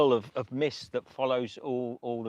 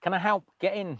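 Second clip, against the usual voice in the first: higher-pitched imitation of a helper; retold helpful officer; 8 semitones higher than usual. Police officer offering help to drenched walkers, higher-pitched imitation. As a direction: lift the pitch to mimic her offer.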